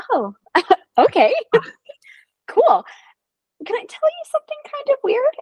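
high, giggly, awkward; student nurse laughing awkwardly before a weird confession; nervous laughing. Retold or imitated. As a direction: laugh through it nervously, pitch way up.

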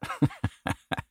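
quiet, breathy chuckle to oneself; helpless little laugh under the breath; short laugh. Real, not acted. Host apologizing for unanswered old letters, quiet chuckle.

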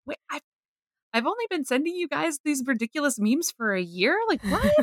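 high incredulous question; baffled, rising to a squeak on what; baffled disbelief. Retold or imitated. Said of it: "other times it's like".

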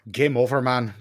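a light, hammed-up Irish brogue; Irish accent. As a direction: toss off the line in a mock Irish accent. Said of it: "my Irish accent is garbage".

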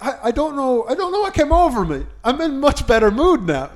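high, sing-song breeziness; cheery. Retold or imitated. Recovered man explaining himself to the teller, mock cheery. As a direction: imitate him brushing it off cheerily. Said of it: "now he's a bit cheery".

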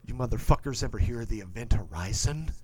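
ominous scientist. Retold or imitated. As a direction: loom over the crew with a dark question. Doctor Weir voiced in retelling.

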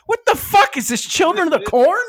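loud, high-pitched disbelief; high comic yelp of bewilderment; incredulous outburst. Retold or imitated. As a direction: blurt it out loud, utterly baffled. Bewildered reaction in acted retelling.

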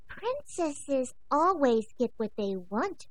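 slow, high, prim princess voice; dainty princess dog. Acted. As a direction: say it slowly like a spoiled princess.

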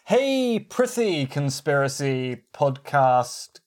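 hammed-up olde English, lightly voiced; mock Elizabethan flourish, a bit like fake Italian; Elizabethan flowery voice. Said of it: "I'm trying to do Elizabethan".

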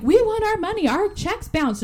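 high, loud imitation of angry creditors; mock outrage, pressing for payment; indignant demand. Unpaid crew confronting the studio owner, acted indignation.

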